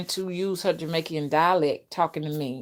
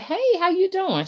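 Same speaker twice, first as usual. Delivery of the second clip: much higher, cheery hello; bright greeting; 12 semitones higher than usual. Retold or imitated. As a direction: greet her all sunny and sweet. Friendly greeting in retold chat.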